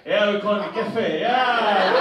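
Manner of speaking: ordinary talk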